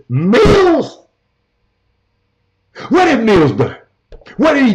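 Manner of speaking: a high, loud, disbelieving "Mills?"; can't believe anyone backs him; incredulous. Real, not acted. Sports show host to a caller, stunned and loud at the name.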